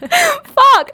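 high laughing outburst; laughing shocked curse. Real, not acted. Laughing exclamation recalling disaster.

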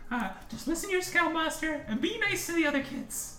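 high motherly advice; mom's voice. Acted. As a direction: send the kid off kindly.

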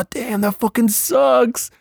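high, drawn-out wail of disappointment; slow, whiny retold lament; hammed-up dismay, close to tears; mock near-crying. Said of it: "he's, like, crying almost".

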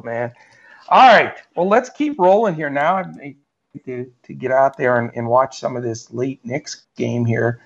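usual speaking voice

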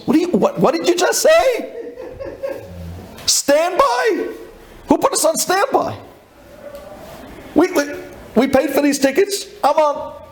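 very high-pitched indignant sputtering; incredulous outrage. Retold or imitated. Passenger learning he was put on standby, outraged. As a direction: sputter in disbelief, voice shooting way up.

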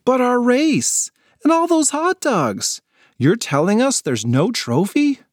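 can't believe there's no prize; disbelieving kid. Acted.

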